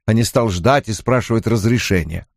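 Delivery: usual speaking voice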